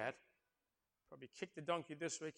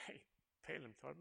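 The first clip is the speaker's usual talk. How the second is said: ordinary talk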